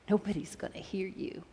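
whispered threat; menacing whisper. Retold or imitated. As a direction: breathe the threat, unvoiced and low. Abuser's threat retold.

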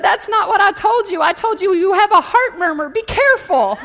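loud, indignant doctor's comeback; exasperated retort. Retold or imitated. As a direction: deliver the punchline as an alarmed doctor.

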